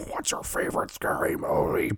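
raspy, voiceless menacing whisper; Ghostface. Retold or imitated. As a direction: imitate a horror-film phone killer. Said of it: "he's basically Ghostface and Scream".

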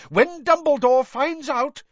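high, loud angry yell, cut off. A narrator's reading. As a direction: keep yelling until you are cut off. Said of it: "yelled Professor McGonagall".

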